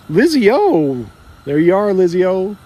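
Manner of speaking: happy call-out. Real, not acted. Greeting wife on stream.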